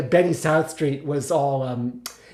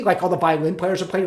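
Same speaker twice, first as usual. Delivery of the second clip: ordinary delivery, nothing marked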